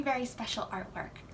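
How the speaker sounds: ordinary delivery, nothing marked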